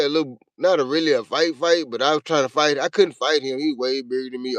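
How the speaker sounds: usual speaking voice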